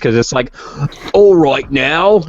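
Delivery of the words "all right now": slow, exaggerated Cockney drawl; broad mock Cockney, drawn right out; Cockney impression. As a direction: put on an extreme Cockney accent. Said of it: "that's a good impression".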